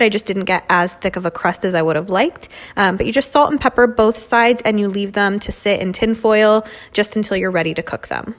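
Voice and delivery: usual speaking voice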